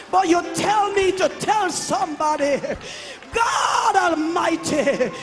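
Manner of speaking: ordinary delivery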